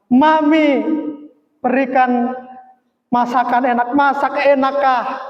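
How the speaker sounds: high, pleading call to mum; acted yell with stretched-out endings; imitated child's cry. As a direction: re-enact a child crying out for food. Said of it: "Kurang lebih begitu waktu itu teriak".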